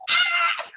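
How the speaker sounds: high laughing shriek at full voice; laughing scream. Retold or imitated. Patient shrieking with laughter on the ambulance stretcher. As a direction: let out a scream that breaks into laughter. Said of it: "screaming and laughing at the top of my voice".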